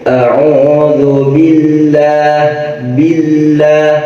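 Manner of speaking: "billah" chanted with a light, thin l; slow chanted "billah", l thin; thin lam. Shown as a demonstration. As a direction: keep the lam light, barely touching. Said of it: "lamnya tarkik, nggak usah tebel-tebel".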